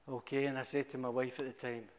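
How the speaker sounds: normal talking voice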